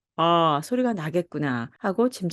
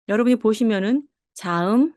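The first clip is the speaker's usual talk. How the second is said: usual speaking voice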